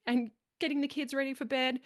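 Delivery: imitating a fed-up wife listing chores; mock exasperated wife. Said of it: "They're like".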